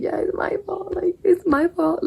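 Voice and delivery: high, whispery sheepish mock voice; mock guilty self. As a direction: play yourself as a guilty little kid, high and breathy. Mocking her own guilty reply.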